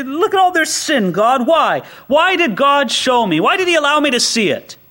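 lightly mock the complaining believer; exasperated plea to God. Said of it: "You say".

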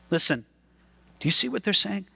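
ordinary talk

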